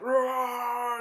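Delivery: high loud giant roar; hammed-up gingerbread giant roar; monster roar. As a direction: bellow it like a falling giant. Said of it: "and he's like".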